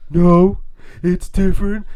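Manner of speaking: loud, high-pitched mocking imitation; mimicking his objection. Quoting Robin arguing over hanging out, loud high mimicry. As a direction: whine it high and loud as the other guy. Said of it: "But he's like".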